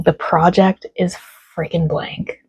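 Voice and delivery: ordinary delivery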